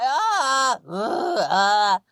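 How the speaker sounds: imitating a mother in labor moaning and crying out; mock labor groans. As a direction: groan and half-shout through a contraction. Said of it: "She's just like".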